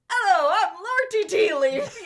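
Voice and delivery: loud, high, bombastic hello; aggressive greeting. Retold or imitated. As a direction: barge in and announce yourself with gusto. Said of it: "open the door so aggressively and be like".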